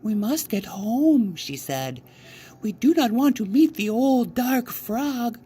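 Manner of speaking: high, hurried worried mother voice; a mother anxious to get home before dark; lightly fearful, high and urgent. Acted. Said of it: "My mother was worried".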